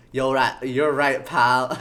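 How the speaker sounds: loud put-on detective voice; Gumshoe, loud. Detective concedes to his pal, loud and blustering. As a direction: belt out the gruff cop's concession. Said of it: "I can only do it when he's being loud".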